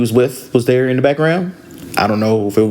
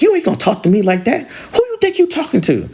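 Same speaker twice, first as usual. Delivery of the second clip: high-pitched indignant challenge; angry retort; 11 semitones higher than usual. Retold or imitated. Angry sister answering her sister's warning, indignant. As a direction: imitate an angry sister talking back. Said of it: "I'll say the angry sister".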